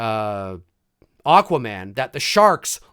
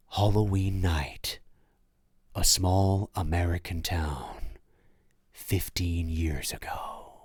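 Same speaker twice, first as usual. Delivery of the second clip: low, slow trailer narration sinking to a whisper; ominous movie-trailer voiceover, ending whispered; horror trailer voice; 10 semitones lower, 7 dB quieter, slower than usual. Pretend. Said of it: "hit us with an improv Halloween trailer voiceover".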